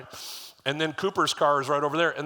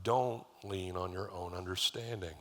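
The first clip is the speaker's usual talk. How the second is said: gentle fatherly advice; 6 semitones lower, 8 dB quieter, slower than usual. Retold or imitated. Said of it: "he's like".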